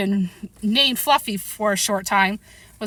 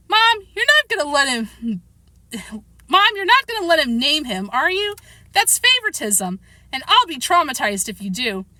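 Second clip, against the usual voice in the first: put-on whiny protesting boy; 6 semitones higher than usual. A narrator's reading. Boy appealing to his mother over favoritism, high indignant whine. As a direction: protest to Mom in a high child's voice.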